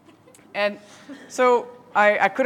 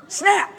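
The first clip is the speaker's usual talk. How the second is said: snap; 10 semitones higher, 5 dB louder than usual. Retold or imitated. Kid snapping the pencil.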